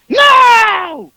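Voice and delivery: loud, high-pitched outburst; Howard losing it. Retold or imitated. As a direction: explode into a drawn-out panicked yell. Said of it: "Howard loses it".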